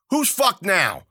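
high, loud triumphant sneer; gloating taunt. Acted. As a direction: throw it at the corpse. Taunting after killing Zoot.

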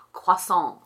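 show-off French pronunciation of "Croissant"; affected bilingual accent. Acted. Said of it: "you're, like, bilingual, and you say things like".

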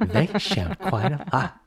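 laughing flight attendant announcement; polite cabin crew voice, with a laugh; stewardess voice. Pretend. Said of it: "The stewardess voice is coming out again".

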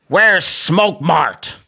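very slow mock American accent; mock American tourist asking. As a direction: drawl it like the American lady. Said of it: "she was American".

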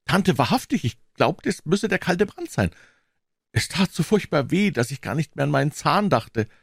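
put-on high, small boy's voice; high-pitched, subdued protest; meek, dejected. A narrator's reading. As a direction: make excuses sheepishly. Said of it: "Kleinlaut und niedergedrückt".

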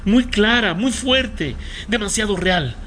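high, intense and insistent; urgent revelation. Acted. Medium telling a mother of her daughter's plea, urgent.

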